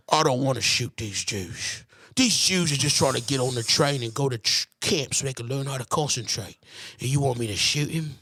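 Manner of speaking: a lightly voiced reluctant soldier; good Nazi voice. Mocking Michael Caine's good Nazi, a lightly voiced reluctant soldier. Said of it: "a good Nazi who, like".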